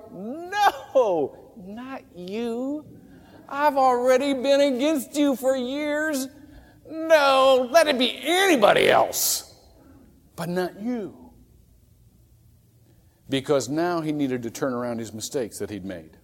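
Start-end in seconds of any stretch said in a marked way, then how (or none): 0.1-11.3 high, pleading protest; Saul's dismay. Retold or imitated. Preacher voicing Saul meeting Jesus on the road, high pleading dismay. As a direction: beg like a man caught out. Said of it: "Can you imagine what he felt like".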